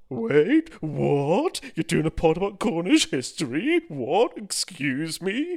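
high, loud outraged put-on voice; hammed-up indignant listener voice; mock outraged listener. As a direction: play a scandalised Welsh fan, shrieking disbelief. Mock outraged Welsh listener bit.

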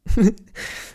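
a high burst of real laughter. Real, not acted. Vlogger laughing at his history joke, high laugh. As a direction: laugh at your own joke.

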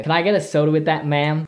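British speaker's take on American; put-on American accent. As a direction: slip into an American accent to order. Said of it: "when you do that accent".

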